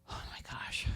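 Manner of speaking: breathy, whispered amazement; hushed marvelling to oneself; awed whisper. Retold or imitated. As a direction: whisper it, overawed, under the breath. Retold awed reaction from her seat.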